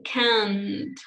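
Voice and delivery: "can't" slowly drawn out; long CAN'T. Shown as a demonstration. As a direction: hold the vowel of "can't" long. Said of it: "Long, not short".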